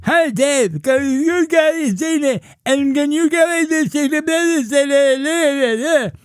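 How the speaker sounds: high, slow mock deaf-accent voice. Cashier calling Dave with a request, high mock voice. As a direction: drag it out high, mimicking her slurred request. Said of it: "she would call me and she'd say".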